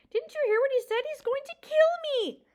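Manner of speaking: high, loud frantic plea. A narrator's reading. Frightened mouse pleading with the porcupine, frantic. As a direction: panic in every word. Poppy terrified and angry.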